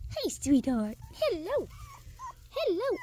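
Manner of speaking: high, rising coo to a puppy; sing-song hello to the little dog. Real, not acted. Owner greeting the puppy in baby talk.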